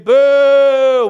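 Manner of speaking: loud, drawn-out crowd boo, held high; yelled boo. Retold or imitated. High-school fan booing the visiting team, loud yelled boo. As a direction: boo them like a rowdy home fan. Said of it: "I would yell and scream".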